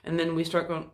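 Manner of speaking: ordinary talk, nothing marked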